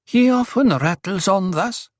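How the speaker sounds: high-pitched, mildly worried woman's voice. A narrator's reading. Duri excuses the dwarf to Paul, worried. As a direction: apologize for him with a touch of worry. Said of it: "worry in her voice".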